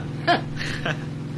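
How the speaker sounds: breathy, wheezy laugh falling off; amused laugh. Acted. Jack laughing off doubts about his pilot. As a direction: laugh at the silly suspicion.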